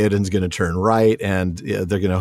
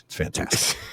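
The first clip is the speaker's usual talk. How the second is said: whispered; 8 dB quieter than usual. Real, not acted. Aside after praising Tolkien academia.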